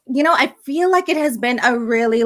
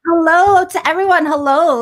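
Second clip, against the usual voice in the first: loud greeting; 2 semitones higher, 7 dB louder than usual. Real, not acted.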